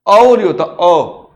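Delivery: औ said loud as one separate vowel; sound demonstration. Shown as a demonstration. Teacher showing pupils how the vowel sounds, demonstrative. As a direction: show the vowel औ on its own, emphatically. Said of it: "तो ये sound ऐसे रहेगा".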